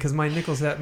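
ordinary talk, nothing marked

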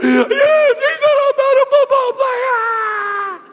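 put-on high, slow wailing boy; crying kid. Father retelling his son's sobbing answer, high slow wail. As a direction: drag it out in a sobbing child's wail. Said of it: "He goes".

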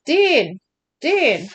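calling out a name loudly; urgent shout across the room; yelling. Acted. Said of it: "Sam suddenly yells".

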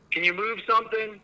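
high, coaxing question to a spirit; calling out to spirits. Real, not acted. Ghost hunter calling to spirits.

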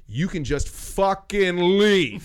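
slow, loud, high and emphatic; fed-up order. Retold or imitated. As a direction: stretch out the order to go. Acted ultimatum to customer.

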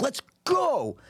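high, exultant shout of victory; excited outburst. Retold or imitated. Player celebrating his winning call at the table, high exultant cheer. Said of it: "I bang the table like".

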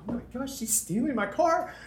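startled cry of panic; alarmed. Retold or imitated. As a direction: sound the alarm, pitch jumping up. Alarm at car theft.

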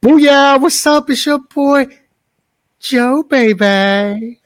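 high, loud hyped-up shout; hype shout. Acted. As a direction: burst in like a wrestler entering the ring. Co-host hype entrance.

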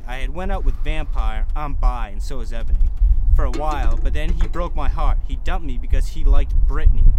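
put-on loud, over-the-top dramatic reading of teen fan fiction; hammed-up fanfic reading. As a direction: belt out the fan fiction like a melodramatic diary.

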